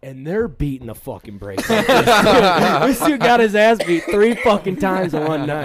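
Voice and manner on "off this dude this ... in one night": loud laugh running into the words, high; cracking up at his own punchline; laughing loudly. Real, not acted. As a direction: laugh it out while you tell it.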